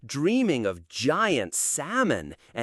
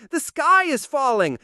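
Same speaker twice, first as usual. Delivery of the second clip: panicked cry; 9 semitones higher, 4 dB louder than usual. Acted. Panicked bird repeats.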